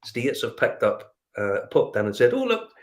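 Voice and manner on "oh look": excited. Retold or imitated. Excited find in liquor store.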